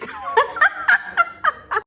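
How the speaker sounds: a sudden high burst of laughter. Real, not acted. Laughing at Erica's joke, high cackle.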